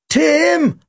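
high, long call of a name; shouted. A narrator's reading. Narrator calling his partner over to escape, shouting. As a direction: call out to your partner from across the room. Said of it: "I shouted".

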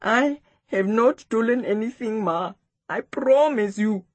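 mildly upset, earnest denial; close to crying. A narrator's reading. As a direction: plead innocence, a touch tearful. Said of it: "The boy was close to crying".

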